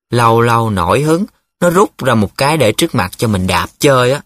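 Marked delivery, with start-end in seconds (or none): none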